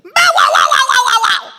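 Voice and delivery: loud high barking bow-wow; loud, high dog barks; mama barking. Acted. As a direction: bark like a big dog to scare the cat. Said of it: "and she said".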